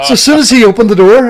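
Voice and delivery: excited retelling of the door moment. Real, not acted. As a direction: build to the punchline. Excited punchline telling.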